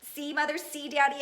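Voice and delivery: high, loud calling to parents; see Mother, see Daddy, called loudly and high. Acted. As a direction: call out proudly across the pool. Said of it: "I called".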